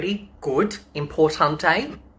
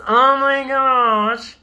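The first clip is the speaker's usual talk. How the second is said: delighted surprise; 8 semitones higher, 6 dB louder, much slower than usual. Real, not acted. Greeting a viewer joining live.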